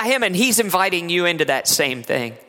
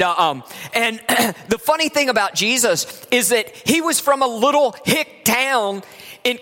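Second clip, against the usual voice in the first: ordinary delivery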